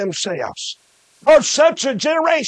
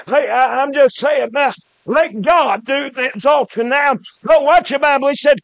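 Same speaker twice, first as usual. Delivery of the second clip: normal talking voice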